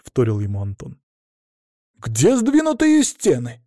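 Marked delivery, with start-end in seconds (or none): 2.0-3.6 high, drawn-out mock outrage; showily indignant. A narrator's reading. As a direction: make a show of protesting. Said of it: "демонстративно возмутился я".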